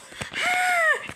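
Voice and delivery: sharp high startled gasp. Acted. Bear gasping at the news of buildings. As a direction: gasp in amazement. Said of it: "Barton gasped".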